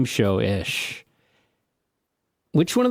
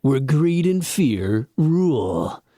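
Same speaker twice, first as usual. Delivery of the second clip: horror trailer voice; slower than usual. Pretend. Mock movie trailer line.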